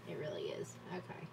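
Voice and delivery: breathy aside, barely voiced; soft unvoiced aside; whisper. Real, not acted. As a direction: whisper it to yourself. Aside to self.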